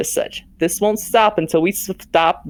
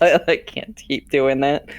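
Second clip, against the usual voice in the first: usual speaking voice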